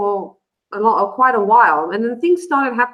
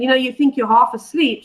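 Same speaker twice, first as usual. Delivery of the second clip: normal talking voice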